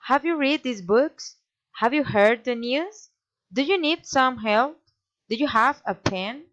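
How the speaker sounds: yes-no questions climbing at BOOKS, NEWS, HELP, PEN; rising intonation. Shown as a demonstration. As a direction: lift the pitch sharply on each last word, as in BOOKS. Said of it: "raising intonation".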